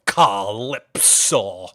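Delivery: slow, low, drawn-out pirate captain; Davy Jones impression. Asked by chat for his Davy Jones, slow low pirate voice. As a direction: loom over the word like a cursed sea captain. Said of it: "I had a Davy Jones impression".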